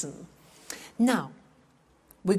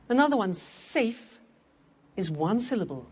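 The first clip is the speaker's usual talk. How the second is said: usual speaking voice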